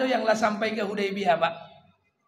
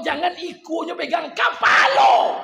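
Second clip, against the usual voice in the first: commanding shout; 8 semitones higher, 10 dB louder than usual. Acted. God commanding Musa.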